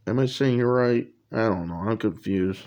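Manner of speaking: ordinary delivery, nothing marked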